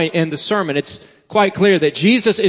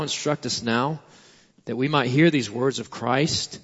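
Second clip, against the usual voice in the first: softer, falling prayer voice to God; 6 semitones lower, 6 dB quieter than usual. Real, not acted. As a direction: pray quietly and humbly on behalf of all. Leads the congregation in prayer.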